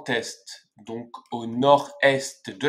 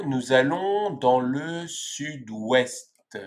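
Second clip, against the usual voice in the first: ordinary talk, nothing marked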